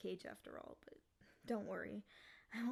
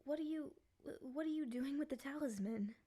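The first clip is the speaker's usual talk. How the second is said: surprised; 5 semitones higher than usual. Acted. Demon notices talisman being used.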